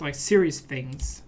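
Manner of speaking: usual speaking voice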